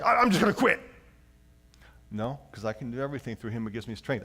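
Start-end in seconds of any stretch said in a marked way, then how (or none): 0.0-0.8 high, loud whiny giving up; mock defeated. Pastor mocking a quitter's attitude, high and whiny. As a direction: throw up your hands and quit. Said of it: "feel like".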